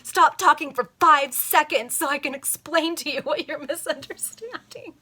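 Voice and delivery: loud, high, impatient snap; mock modern exasperation; exasperated retort. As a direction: cut him off, fed up. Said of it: "What she should have said was".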